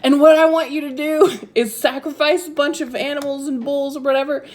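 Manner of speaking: high, loud orders through laughter; laughing mock command from God. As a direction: giggle while bossing them around. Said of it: "he's basically like".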